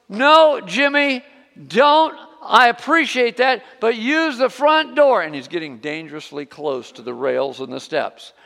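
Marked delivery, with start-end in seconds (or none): 0.1-5.2 high, loud firm warning; insistent yell. Retold or imitated. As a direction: raise the voice to stop him. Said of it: "She goes".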